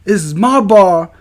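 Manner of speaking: high, loud mock threat; hammed-up furious bar owner; imitating an angry owner. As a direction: bark it like a huge bar owner squaring up. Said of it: "And then you hear him go".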